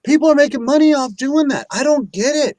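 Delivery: can't believe it; incredulous. Real, not acted. Disbelief at ASMR money.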